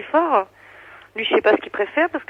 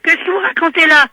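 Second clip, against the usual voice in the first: loud, high incredulous question; real indignant challenge; 7 semitones higher, 7 dB louder than usual. Real, not acted. Woman disputing the operator's claim, indignant. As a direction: challenge the caller loudly, voice pitched up.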